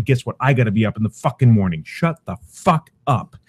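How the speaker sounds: usual speaking voice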